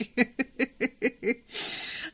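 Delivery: bright rising laugh; real laugh. Real, not acted. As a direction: let a real laugh bubble up. Laughs after the quote.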